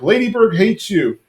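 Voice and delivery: high-pitched, hammed-up parting jab; mock petty taunt. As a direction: imitate a husband's childish comeback. Petty parting shot in retold quarrel.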